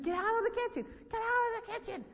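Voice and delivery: high, strained yell at normal volume; retold screaming. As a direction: reenact yelling downstairs at someone. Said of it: "And I'm screaming".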